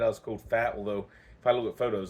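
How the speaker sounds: ordinary talk, nothing marked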